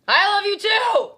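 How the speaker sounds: high, loud over-the-top declaration; mock shouted affection. Co-host answering partner's yelled praise, shouting. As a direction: yell it back with hammed-up feeling.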